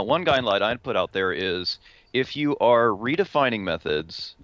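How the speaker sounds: usual speaking voice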